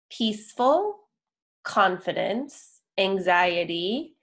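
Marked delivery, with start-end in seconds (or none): none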